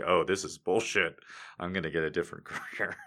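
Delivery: quick, soft grumble; mock fed-up actor. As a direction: mutter it under your breath. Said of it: "the only guy who was all like".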